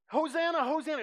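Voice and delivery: high, loud joyful Hosanna cry; crowd bursting out. Retold or imitated. Crowd erupting after the empty jar, loud cry. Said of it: "the crowd bursts out again".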